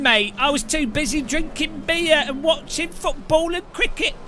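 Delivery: mock a pub-going Brit in an American-style British accent; cliche British accent. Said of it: "Bloody British people are like".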